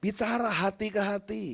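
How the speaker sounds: slow, softened heart-to-heart invitation; hushed sincere plea; tender appeal. Real, not acted. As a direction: draw them in gently. Drops into intimate appeal.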